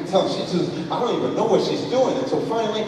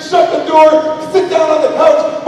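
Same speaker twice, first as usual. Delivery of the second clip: ordinary talk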